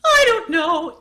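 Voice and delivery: high lovestruck "I don't know". Shown as a demonstration. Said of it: "the way he said".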